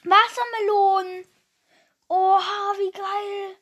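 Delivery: thrilled at a good flavour; delighted shout; excited exclamation. Real, not acted.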